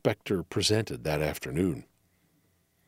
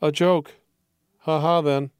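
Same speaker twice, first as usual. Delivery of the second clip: sober, dry; 4 semitones higher, 4 dB louder than usual. Acted.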